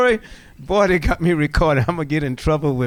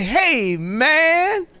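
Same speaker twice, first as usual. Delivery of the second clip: Thomas greeting; 11 semitones higher, 4 dB louder than usual. Retold or imitated. Clarence Thomas greeting warmly.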